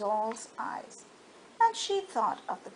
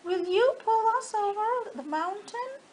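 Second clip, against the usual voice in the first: high, near-tearful begging from the toys; pleading dolls; 7 semitones higher than usual. Acted. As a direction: beg her with a catch in the voice. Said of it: "cried the dolls and toys".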